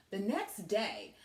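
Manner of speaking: ordinary delivery, nothing marked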